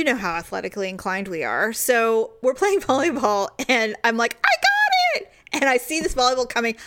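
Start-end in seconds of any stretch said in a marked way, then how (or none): 4.4-5.3 high, excited call-out; eager high-pitched mock holler; calling for the ball. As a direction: call it out high across the court. Retold volleyball call on the court.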